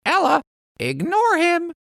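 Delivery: very high parrot echo; parrot copying. Acted. Parrot copying Lizzie, very high parrot voice. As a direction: squawk the words back like a parrot. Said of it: "Parrot repeats".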